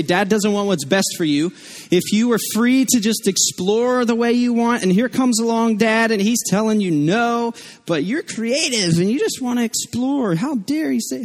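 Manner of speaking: high-pitched mock indignation; imagined toddler's grievance. Imagined voice egging toddler on against Dad, mock indignant. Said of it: "who taught him to say".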